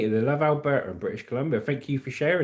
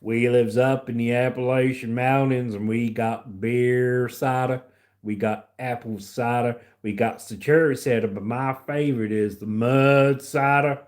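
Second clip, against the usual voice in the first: put-on hillbilly accent; 3 semitones lower than usual. Answering viewers who love it, hillbilly accent. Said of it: "the hillbilly accent".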